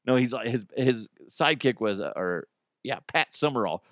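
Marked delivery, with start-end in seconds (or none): none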